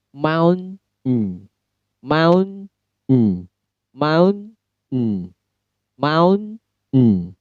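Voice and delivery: "Mount" with the T held and a nasal "mm"; American held T. Shown as a demonstration. Teacher practising the nasal held T with the class. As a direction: stop the T and hum "mm" through the nose. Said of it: "Cara bacanya adalah nasal sound".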